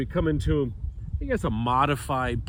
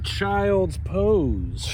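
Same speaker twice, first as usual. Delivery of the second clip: odd drawn-out cue; 6 semitones higher than usual. Real, not acted. Coach cueing class, slow drawn-out call. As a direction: drag the words out oddly. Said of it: "I don't know why I said it like that".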